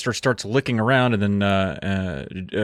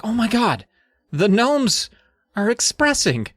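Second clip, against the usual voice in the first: put-on astonished kid; 11 semitones higher than usual. Joe seeing the gnomes express, high-pitched mock astonishment. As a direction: act out a kid's awed discovery.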